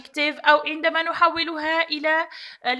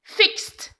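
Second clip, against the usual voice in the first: usual speaking voice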